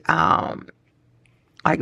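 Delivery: ordinary talk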